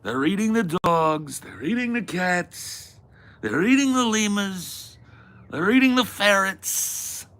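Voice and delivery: building absurd list in a mock Trump voice; Trump impression. Parody of Trump debate line.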